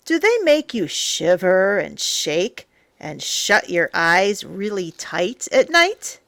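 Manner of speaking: plain reading voice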